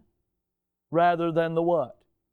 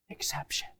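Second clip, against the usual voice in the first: whisper; 12 dB quieter than usual. Acted. Congregation answer, whispered aside.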